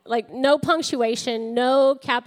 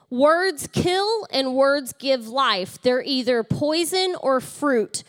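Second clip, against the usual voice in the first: slow scripture reading; slower than usual. Acted. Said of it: "the Message Bible says it like this".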